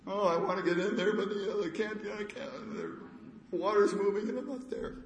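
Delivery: high, fast whiny excuses with a laugh; rambling paralytic. Retold or imitated. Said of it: "giving a, his whole life story".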